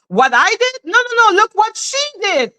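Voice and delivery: mocking eighty-two. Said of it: "That's what eighty-two does".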